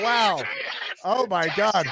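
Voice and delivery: ordinary talk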